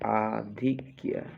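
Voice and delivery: "आधिक्य" slow, with long आ; sounded out for dictation. Shown as a demonstration. Teacher dictating a spelling, आधिक्य slowly sounded out. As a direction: stretch आ, धिक्, य in "आधिक्य".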